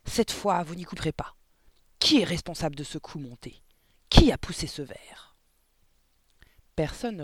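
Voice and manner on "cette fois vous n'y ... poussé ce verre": loud angry demand to confess; furious demand. A narrator's reading. Headmistress demanding the culprit confess, loud anger. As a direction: hammer the class for a culprit. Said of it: "rugit-elle".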